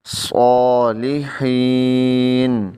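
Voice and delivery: صالحین drawn out slowly, the ح from the throat; slow careful reading. Shown as a demonstration. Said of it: "ہا کا خیال رکھنا ہے". (حلق سے حا)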